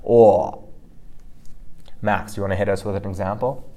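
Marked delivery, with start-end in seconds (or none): 0.1-0.7 "O" as loud froggy aw from the chest; throaty pinyin O. Shown as a demonstration. Teacher modelling chest vibration, "O" as throaty aw. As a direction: vibrate "O" in the throat, mouth open. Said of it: "vibration in your throat".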